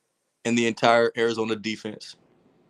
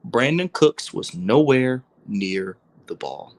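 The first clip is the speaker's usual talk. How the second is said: slow and deliberate, word by word; slow, emphatic repeat; 3 dB louder, slower than usual. Real, not acted. Host repeating his point on Dak's throw, slow and emphatic. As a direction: drive the point home slowly. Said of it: "I'm gonna say this again".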